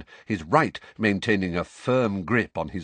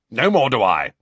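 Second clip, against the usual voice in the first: much louder, forceful; 4 semitones higher, 9 dB louder than usual. A narrator's reading. Uncle forcefully backing his wife. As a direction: put weight behind it. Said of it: "said Uncle Vernon forcefully".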